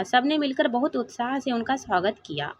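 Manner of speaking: plain reading voice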